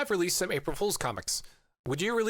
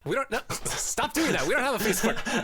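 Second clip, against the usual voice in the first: laughing protest; 7 semitones higher than usual. Real, not acted. Host teasingly contradicting co-host.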